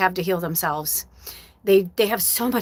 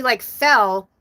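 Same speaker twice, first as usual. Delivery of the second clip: usual speaking voice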